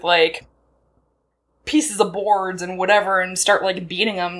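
ordinary delivery

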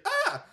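short high mock scream; imitated scream. Retelling the wife waking up, mock scream. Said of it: "She's like".

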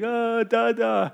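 put-on high, drawn-out toddler whine; imitating crying toddler. Father mimicking son at the door, high babbled whine. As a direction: mimic a little boy calling for Dad. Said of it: "and he's like".

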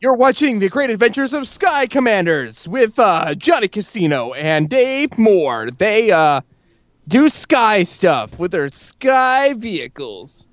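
hammed-up TV announcer voice; cartoon announcer. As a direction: play the eighties cartoon narrator, slowing at the end.

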